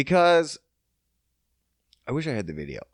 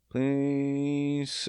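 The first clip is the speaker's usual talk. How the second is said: ordinary talk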